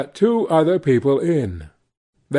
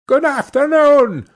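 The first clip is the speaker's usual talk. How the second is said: higher and louder curt farewell; sharp dismissal; 12 semitones higher, 6 dB louder than usual. Acted. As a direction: snap the goodbye to end it. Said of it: "said Scrooge".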